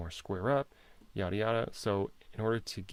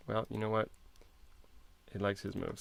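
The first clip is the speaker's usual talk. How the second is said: ordinary delivery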